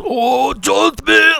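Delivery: high, slow, excited mock cry; imitating excited Japanese fans. Host recreating the band geeking out, high excited cry. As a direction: mimic the band's delighted shout. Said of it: "that's my recreation".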